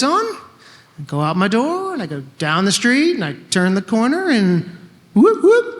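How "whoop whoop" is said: high loud whooping siren; siren imitation. Telling of being stopped again, siren whoop. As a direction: mimic the police siren.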